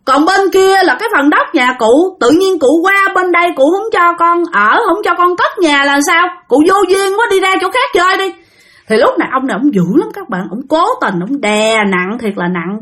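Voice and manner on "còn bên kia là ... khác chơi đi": higher, scoffing and dismissive; father scoffing at the old man and sending him off; mocking scorn. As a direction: laugh him off and shoo him away. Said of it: "cái ba nói rằng".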